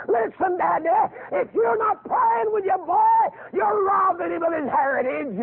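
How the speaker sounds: rising shouted preacher climax; preaching at full force. Acted.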